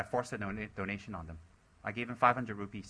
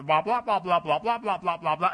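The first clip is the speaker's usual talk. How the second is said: loud, high string of blahs; mimicked meeting squabble; 6 semitones higher, 8 dB louder than usual. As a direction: babble loudly like a squabbling committee.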